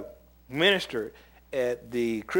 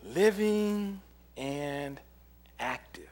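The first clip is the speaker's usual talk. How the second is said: slow emphasis; 3 dB quieter, much slower than usual. Shown as a demonstration. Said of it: "drawn out scripture phrase".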